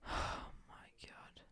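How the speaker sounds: slow, breathless whisper of disbelief; despairing whisper. Real, not acted. Whispered after a miss.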